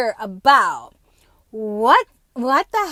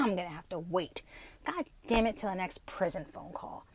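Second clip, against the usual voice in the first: much softer, under the breath; whisper; 5 semitones lower, 11 dB quieter than usual. Real, not acted. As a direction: mutter it to yourself. Grumbling about waiting, whispered.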